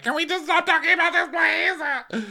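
mocking whiny Scheana. Recapping Scheana begging to drop it, in a high whine. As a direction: squeak it out like a pleading girl.

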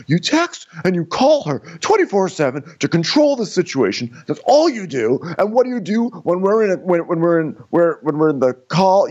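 high-pitched mock accusing rant; Austin. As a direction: scold in a hammed-up voice, tripping over the words. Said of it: "Austin's like".

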